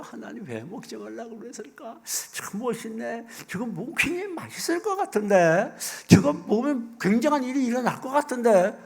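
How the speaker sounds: tempted inner voice. Acted. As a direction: voice a tempting thought, high and growing keener. Acting out Eve's tempted thoughts.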